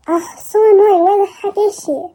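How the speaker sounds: finally snapping with real irritation; annoyed outburst. Acted. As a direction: let the annoyance burst out. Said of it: "how do I become annoyed?".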